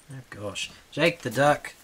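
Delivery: normal talking voice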